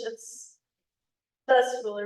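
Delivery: normal talking voice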